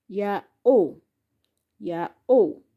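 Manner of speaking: "Ya, o" split in two, said twice; syllable by syllable. Shown as a demonstration. Teacher repeating two syllables for learners, syllable by syllable. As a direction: separate Ya from o each time. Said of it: "That is two syllables".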